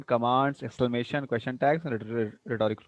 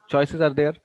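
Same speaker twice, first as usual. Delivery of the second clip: ordinary delivery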